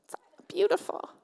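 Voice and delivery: high, bright "beautiful" gushed out; gushing admiration. Acted. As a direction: swoon over the old book. Admiring the Bible.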